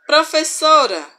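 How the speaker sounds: high, loud call to the teacher; student calls the teacher, raising the voice. Shown as a demonstration. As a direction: call out to get the teacher's attention. Example of calling the teacher.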